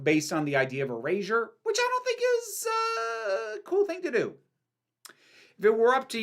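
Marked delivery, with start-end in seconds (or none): 1.6-4.3 squeaky high pitch. Real, not acted. Sudden high-pitched comment.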